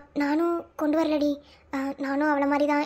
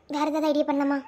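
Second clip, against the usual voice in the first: normal talking voice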